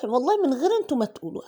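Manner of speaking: fast, laughing self-mockery. Real, not acted. Teasing viewers before they scold her, quick laughing. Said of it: "أنا عارفة والله".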